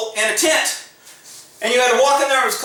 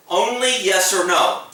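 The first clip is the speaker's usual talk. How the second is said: ordinary delivery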